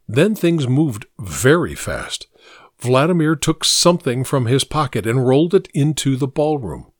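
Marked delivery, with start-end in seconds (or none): none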